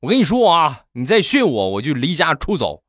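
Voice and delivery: half-hearted shout from a henpecked dad; mock roar. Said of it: "然后大吼一声". (装腔作势地吼)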